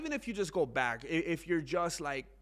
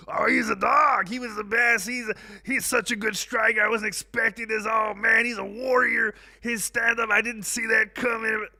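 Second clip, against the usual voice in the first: loud hyped-up mock praise, higher; mock Strickland praise; 5 semitones higher, 7 dB louder than usual. Mocking Strickland praising his opponent, loud and hyped. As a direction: ham up the fake compliments. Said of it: "Sean Strickland came".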